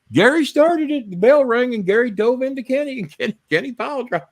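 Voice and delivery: high, breathless rush of tattling; kids' excited report. Retold or imitated. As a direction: blurt it out like schoolkids. Kids telling the teacher.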